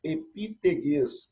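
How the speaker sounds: epiteges, spelled out very slowly; syllable by syllable, epiteges. Shown as a demonstration. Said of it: "significa em grego terra".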